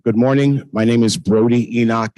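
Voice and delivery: ordinary talk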